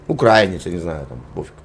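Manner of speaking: ordinary delivery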